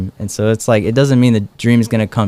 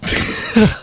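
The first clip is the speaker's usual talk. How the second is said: high burst of laughter; 12 semitones higher than usual. Retold or imitated. Retold reaction to odd answer.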